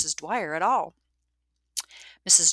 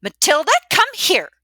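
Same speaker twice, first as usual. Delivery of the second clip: high, very loud sharp command; angry calling out; 13 dB louder than usual. A narrator's reading. Matron ordering Maddie over, angry shout. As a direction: shout an order across the room. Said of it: "as she calls out to Maddie".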